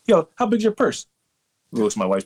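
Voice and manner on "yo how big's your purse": high imitated conspiratorial host; restaurant host impression. Restaurant host eyeing a guest's purse, high mock-host voice. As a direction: mimic the host's sly offer, pitched way up. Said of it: "He's like".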